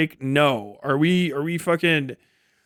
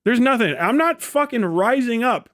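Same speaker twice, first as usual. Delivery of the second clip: ordinary talk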